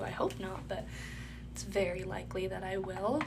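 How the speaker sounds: ordinary talk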